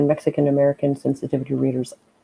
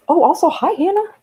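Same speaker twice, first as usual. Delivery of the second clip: cheery greeting; 4 dB louder than usual. Real, not acted. Greeting a viewer.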